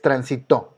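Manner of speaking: transitó with final TÓ stressed; stress on last syllable. Shown as a demonstration. Teacher takes transitó as first word, last syllable stressed. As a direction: land firmly on the end of transitó. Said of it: "es una palabra aguda".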